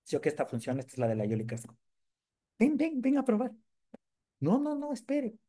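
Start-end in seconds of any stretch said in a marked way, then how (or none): 2.6-5.4 squeaky voice. Acted. Comic voiced figure calling.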